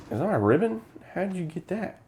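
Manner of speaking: high, questioning imitation; lightly acted startled question; puzzled suspicion. Acting the suspicious man's question.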